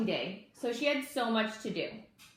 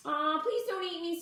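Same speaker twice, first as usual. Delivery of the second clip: much higher, squeaky plea; squeak; 9 semitones higher than usual. A narrator's reading. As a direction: squeak it out like a tiny bug. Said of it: "squeaked Lulu".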